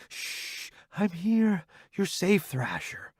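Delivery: soft tender whisper; calm him gently; wife soothing him awake; soothing hush. Acted. Wife soothing after nightmare.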